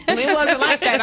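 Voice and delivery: breaking into laughter mid-line; laughing. Real, not acted. Telling friends about him, laughing as she speaks. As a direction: giggle through the words.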